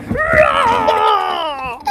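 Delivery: high loud playful roar; dinosaur roar. Pretend. Mum roars like a dinosaur to amuse the babies. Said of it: "Dinosaur says".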